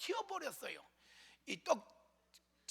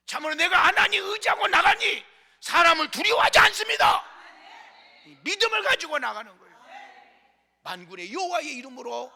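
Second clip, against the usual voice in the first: loud, rising shouted litany of praise; a pastor roaring to the congregation; full-force preaching; 10 dB louder than usual. Acted. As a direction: build each 하나님 line into a shout.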